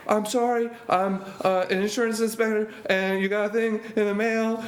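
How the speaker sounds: high, hammed-up panicked begging; mock terrified pleading. Comedian imitates himself begging the bodybuilder, high mock panic. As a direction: plead for mercy like a scared kid, half laughing.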